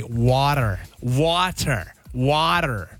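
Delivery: "water" in an American accent; put-on American pronunciation of "water". Shown as a demonstration. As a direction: demonstrate American "water", each one set apart. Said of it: "you've gotta say".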